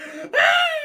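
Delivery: very high, loud shriek; shrieking scream. Retold or imitated. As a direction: scream in fright.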